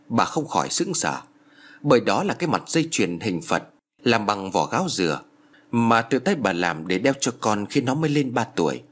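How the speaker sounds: usual speaking voice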